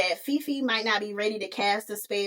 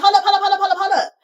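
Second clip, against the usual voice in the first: louder, higher urgent hold up; alarmed hold up; 7 semitones higher, 6 dB louder, much faster than usual. Retold or imitated. Fifi halts the painful spell, loud and urgent. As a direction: stop everything, urgent and loud.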